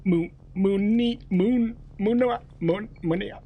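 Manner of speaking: halting stammered attempts at Munia; sounding out Mun- Munua- Munia haltingly; struggling to pronounce. Real, not acted.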